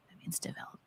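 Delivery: quick whispered aside. Real, not acted. Aside while reading article.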